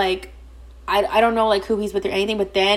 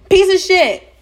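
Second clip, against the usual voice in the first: 1 louder repeated insult; 10 semitones higher, 7 dB louder, slower than usual. Real, not acted. Disgust at married man.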